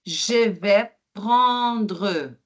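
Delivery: prendre with a strong rolled-back French R, slowly. Shown as a demonstration. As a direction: drag out the R in prendre. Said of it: "il est bien le R fort".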